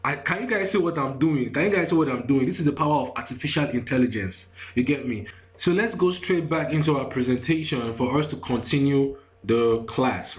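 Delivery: ordinary delivery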